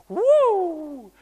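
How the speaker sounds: high, loud whoop of delight; a girl leaping into the pool; joyful whoop. Retold or imitated. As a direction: let out a gleeful child's woo. Child's joyful jump.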